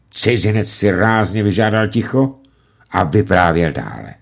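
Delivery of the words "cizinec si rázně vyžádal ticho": ordinary delivery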